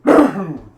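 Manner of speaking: loud rasping smoker's cough. Real, not acted. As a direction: hack it out like a smoker. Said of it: "I have a nasty smoker's cough too".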